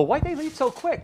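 mock guy asking. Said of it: "some guys would go".

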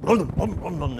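loud, rumbling car noise made by mouth; imitated engine booms. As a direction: boom out the sputtering engine. Imitates the old car's engine.

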